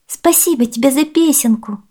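put-on high-pitched grateful child; little girl. A narrator's reading. Girl thanks the bird for its song, high girlish voice. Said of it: "девочка сказала птичке".

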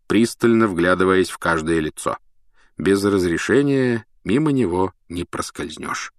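plain reading voice

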